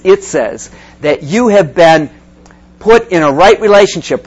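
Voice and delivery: ordinary delivery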